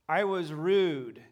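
rude drawn out slowly and falling; slow emphasis. Real, not acted. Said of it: "and I didn't realize it".

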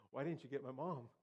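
mock wail of a hurt boy wanting mom; hammed-up childish protest; complaining kid. Child self complaining at dad's arrival.

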